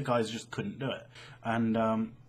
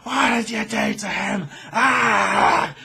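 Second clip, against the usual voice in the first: higher, louder mock shriek of an outraged mum; angry mum; 9 semitones higher, 10 dB louder, slower than usual. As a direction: play the furious mother yelling at the kid. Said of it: "she was like".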